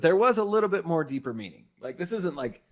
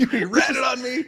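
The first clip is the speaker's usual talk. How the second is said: high, loud mock shout; betrayed outcry; 11 semitones higher, 6 dB louder than usual. Gunman feeling betrayed to the cops, outraged.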